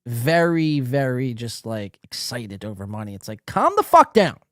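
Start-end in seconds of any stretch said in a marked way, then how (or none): 3.5-4.4 mock yell. Mock retort after money rant.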